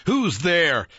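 high, loud shout trying to sound brave. A narrator's reading. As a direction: bluff menace into the dark. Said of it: "I shouted, trying to sound brave".

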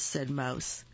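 plain reading voice

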